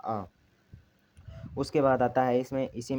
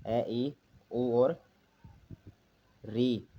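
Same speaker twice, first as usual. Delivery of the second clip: slow vowel listing; much slower than usual. Shown as a demonstration. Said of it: "इसमें चार स्वर आते हैं".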